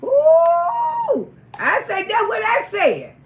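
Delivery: high loud ooh, then shouted; excited ooh. Real, not acted. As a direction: explode with it. Outraged punchline.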